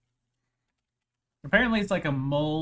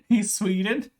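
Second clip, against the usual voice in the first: laughing; 6 semitones higher, 6 dB louder than usual. Real, not acted. Laughs at Swedish translation.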